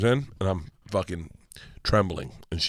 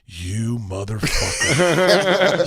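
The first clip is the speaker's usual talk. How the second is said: slow amused cuss, laughing; laughing slow mock insult; much slower than usual. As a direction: laugh through the insult, slowly. Wife laughing at dad.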